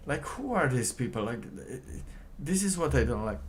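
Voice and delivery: who even are these people; indignant. Real, not acted. Indignant reaction.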